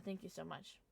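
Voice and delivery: ordinary delivery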